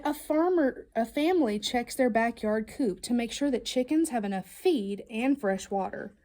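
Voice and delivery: ordinary delivery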